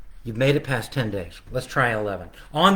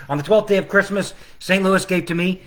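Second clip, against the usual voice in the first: carol verse; 5 semitones higher than usual. Acted. Illustrator presenting day ten of his carol. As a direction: announce it like a carol.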